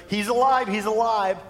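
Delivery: can't contain the joy; joyful shout. Pretend. Disciples running to tell the followers, joyful shouting. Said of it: "their joy temperature is so high".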